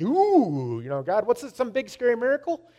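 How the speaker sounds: high, spooked "ooh" rising into a question; mock-spooked, pitch climbing at the end; retold startled reaction. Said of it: "that's my first reaction".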